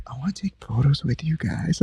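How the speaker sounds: high, hushed, whispery imitation; sweet woman. Sweet woman asking famous guys for photos, high and whispery. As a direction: imitate a kindly fan, soft and high. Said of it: "This is the sweetest woman".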